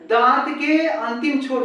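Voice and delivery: ordinary delivery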